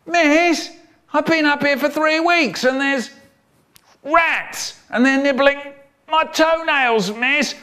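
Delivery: put-on high plaintive child; whiny trapped kid. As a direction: complain to the teacher like a miserable pupil. Child in school prison.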